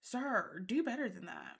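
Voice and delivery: usual speaking voice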